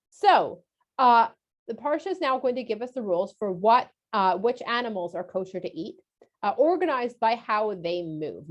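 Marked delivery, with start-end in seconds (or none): none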